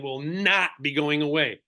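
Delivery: slow, weighty "not"; emphatic repeat, stress on NOT. Real, not acted. Agent trainer repeating the point for viewers, emphatic "not". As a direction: repeat it slowly, leaning on "not". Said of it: "Let me say that again".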